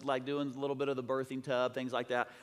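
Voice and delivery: ordinary delivery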